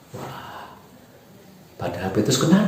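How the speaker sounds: ordinary talk, nothing marked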